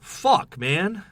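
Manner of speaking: high, loud frustrated curse; exasperated outburst. Retold or imitated. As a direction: blurt it out fed up. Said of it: "I was like".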